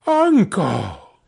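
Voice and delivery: high, falling plea; pleading. A narrator's reading. Nephew pleads with his ranting uncle, pleading. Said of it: "pleaded the nephew".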